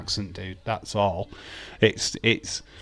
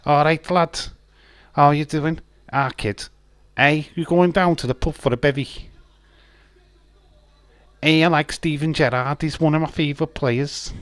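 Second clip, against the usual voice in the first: higher Scouse lad voice; Scouse accent, a touch raised; Liverpool accent; 5 semitones higher than usual. Pretend. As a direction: chat like a Liverpool lad at the pub. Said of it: "It's lad if you're from Liverpool".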